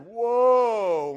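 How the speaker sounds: loud, drawn-out amazed whoa; Adam seeing the woman for the first time, wowed; awed whoa. Retold or imitated. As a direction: let it out in wonder.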